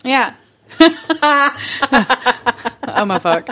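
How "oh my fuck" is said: usual speaking voice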